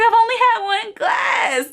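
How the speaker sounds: high, crying protest; man pleading with cops, high and teary; whiny protest. Retold or imitated. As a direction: whine it near tears. Boyfriend defending to cops.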